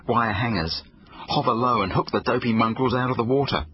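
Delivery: usual speaking voice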